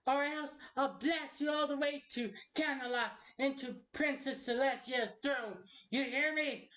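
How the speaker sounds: lightly acted cartoon villain threat; threatening character. Performs a threatening character line.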